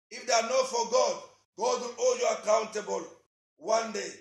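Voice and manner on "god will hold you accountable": ordinary talk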